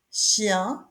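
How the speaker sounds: ordinary talk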